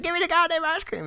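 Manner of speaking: shrill high yell for the ice cream; hammed-up screech at the ice cream man; mock demanding yell. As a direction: scream it way up high like a kid losing it. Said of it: "And then you're like".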